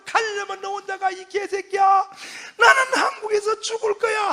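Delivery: high, loud tearful yell with a laugh; half-laughing mock sob of defiance; crying and cursing. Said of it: "막 울면서 욕을 파가지고".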